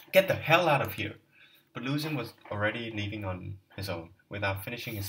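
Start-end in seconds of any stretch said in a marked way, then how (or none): 0.1-1.2 angry shout. Acted. Raskolnikov throws Luzin out.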